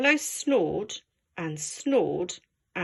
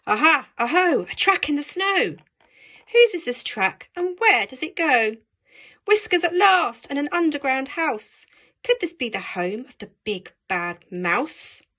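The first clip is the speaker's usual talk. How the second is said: high, louder little voice; excited child; 5 semitones higher, 3 dB louder than usual. Acted. Gruffalo's child spots marks, high excited call. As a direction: call out eagerly.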